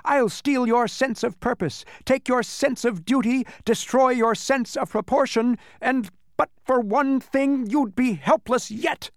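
high gleeful villain voice; cackling demon. A narrator's reading. Demon threatening to steal the senses, high cackling voice. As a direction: gloat in a high cackler's voice, slowing at the end. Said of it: "he cackled".

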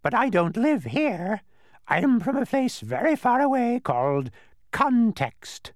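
pine for home in a bird screech; longing bird. A narrator's reading. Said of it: "he said longingly".

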